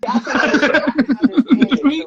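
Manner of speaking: laughing disbelief. Real, not acted. Incredulous laughing reaction.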